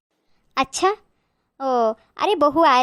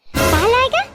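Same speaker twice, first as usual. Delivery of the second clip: surprised question; 8 semitones higher, much slower than usual. Acted. Niece hearing her cousin comes back only tomorrow, surprised. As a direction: catch her off guard, voice jumping up.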